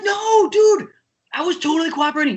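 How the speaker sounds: very high retold denial; exasperated protest. Drummer protesting to his lawyer, exasperated denial.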